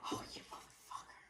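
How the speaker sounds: soft, whispered mock curse; hushed imitation of resentful swearing; retold cursing under breath. Retold muttered cursing at partner.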